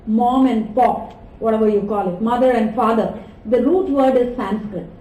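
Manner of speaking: usual speaking voice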